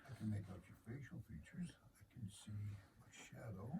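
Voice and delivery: hushed whisper in the dark. Acted. Describes seeing in the dark.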